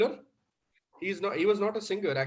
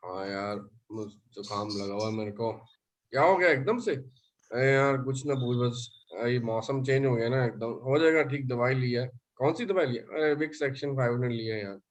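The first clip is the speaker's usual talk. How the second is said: stuffy cold voice; 6 semitones lower than usual. Acted. Coach playing a friend with a cold, lower and stuffy. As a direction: sound like you have a heavy cold. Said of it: "जुकाम में होता है ऐसा".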